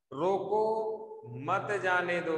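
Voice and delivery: "रोको", then a clear break before "मत जाने दो"; pause after रोको. Shown as a demonstration. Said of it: "आप अगर इसको पढ़े तो".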